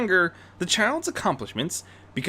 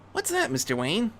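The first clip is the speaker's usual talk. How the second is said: put-on higher young boy voice, asking; lightly voiced eager kid; a hint of a curious eight-year-old; 7 semitones higher, 4 dB quieter than usual. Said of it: "Terry asked".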